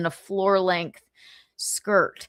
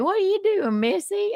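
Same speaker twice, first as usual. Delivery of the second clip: much higher teasing cowboy voice; hammed-up flirty cowboy; lightly voiced cowboy calling out; mock cowboy; 12 semitones higher than usual. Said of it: "he was like".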